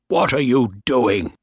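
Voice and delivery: indignant captain snapping a question; angry demand. Acted. Captain confronting mutineers, indignant angry demand. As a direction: demand it with outrage. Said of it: "Bligh said angrily".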